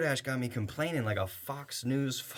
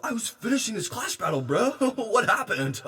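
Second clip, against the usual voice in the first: fast, higher goofy gamer voice; gamer driver voice; 6 semitones higher than usual. Acted. Driver on the phone, fast distracted gamer voice. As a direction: rattle it off like a distracted kid.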